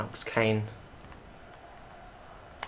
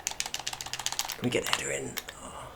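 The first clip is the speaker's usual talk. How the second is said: whispering; 3 semitones higher, 7 dB quieter, slower than usual. Real, not acted. Hushed hope during attack.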